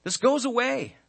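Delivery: high, light voice of reassurance; a hint of a gentle comforter brushing it off; lightly voiced reassuring speaker; reassuring quote. Preacher voices a reassuring figure.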